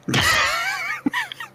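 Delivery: a high, far-reaching laughing yell; a mock yell that breaks into a laugh; laughing shout. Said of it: "I'm gonna yell even louder".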